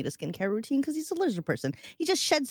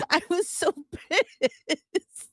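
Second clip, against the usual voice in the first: laughing; 8 semitones higher, much slower than usual. Real, not acted.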